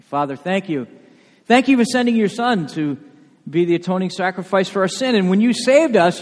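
fervent pastoral prayer rising over music; closing prayer. Acted. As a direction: pray it aloud for the whole church.

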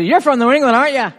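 high, loud pouncing question; loud exclaimed recognition. Retold or imitated. Listener catching the preacher's accent slip, loud exclamation. Acting out someone spotting his accent.

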